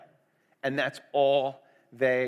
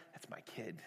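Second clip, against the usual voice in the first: whisper; 7 semitones lower, 23 dB quieter than usual. Pretend. Jesus claims the needy child.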